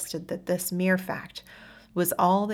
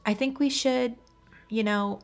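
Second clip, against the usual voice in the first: high, halting, searching for words; hesitant woman voice; 5 semitones higher than usual. Acted. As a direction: struggle to get it out. Hesitant confession.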